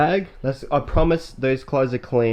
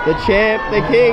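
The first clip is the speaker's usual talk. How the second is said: high, loud hype for a mate; cheering; 10 semitones higher, 5 dB louder than usual. Real, not acted. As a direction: cheer him on like a hero. Cheering a friend's arrival.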